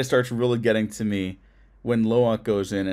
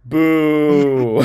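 playful boo; 3 semitones higher, 7 dB louder than usual. Real, not acted. Booing the joke.

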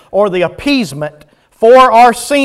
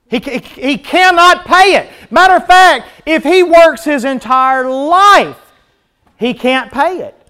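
emphatic preaching climax; 7 semitones higher, 4 dB louder than usual. Real, not acted.

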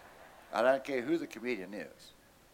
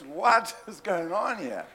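slow, high, breaking into a laugh mid-question; bewildered laughing; 4 semitones higher, 3 dB louder, slower than usual. Retold or imitated. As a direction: cop caught by laughter, baffled. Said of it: "And he says".

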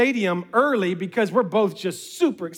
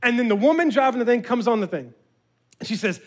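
ordinary talk

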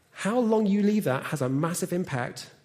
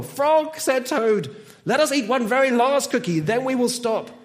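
higher, louder mock pleading; eager urging; 7 semitones higher, 5 dB louder than usual. A narrator's reading. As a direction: urge your friend on, pitched up. Toad urges one more cookie.

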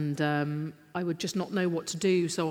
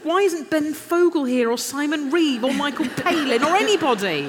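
higher, louder, almost wailing; panicked tearful plea; 8 semitones higher, 7 dB louder than usual. Retold or imitated. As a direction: beg the heavens in mock despair. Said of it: "and then I burst into tears".

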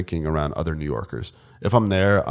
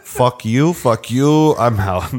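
put-on loud, high New York accent; 5 semitones higher, 6 dB louder, much slower than usual. Joking that Einstein swore, loud New York accent. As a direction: mouth off in hammed-up New York style. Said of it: "That's more New York, though".

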